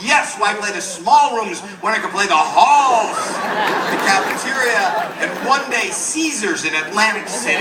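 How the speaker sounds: loud, high, drawn-out retort; grand defiant announcement. Acted. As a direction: proclaim it slowly like a showman. Cheeky boy answering back the teacher.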